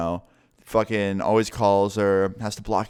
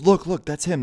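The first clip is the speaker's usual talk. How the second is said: high-pitched mock alarm; retold urgent cry, pitched well up; urgent alert; 8 semitones higher than usual. Girlfriend spotting her ex down the street, urgent alert.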